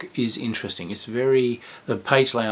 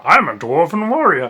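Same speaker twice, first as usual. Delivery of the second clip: naive player; 7 semitones higher, 8 dB louder than usual. Retold or imitated. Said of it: "simple player line".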